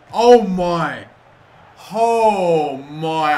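loud, drawn-out cry of disbelief; anguished disbelief. Real, not acted. Gamer reacting to a saved shot, wailing in disbelief. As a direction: wail it at the near miss. Keeper saves, near miss.

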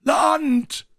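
a sailor shouts from the lookout; lookout's shout. Acted. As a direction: bellow it from high above. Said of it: "rief der Mann am Ausguck".

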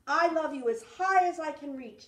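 high, loud and eager stretching reach; a small child proudly shouting how big his love is; excited little hare. Acted. Little hare calling out his love to big hare, high and loud. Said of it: "said Little Nut Brown Hair".

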